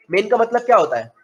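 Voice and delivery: usual speaking voice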